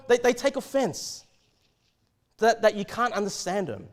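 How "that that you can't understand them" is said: ordinary delivery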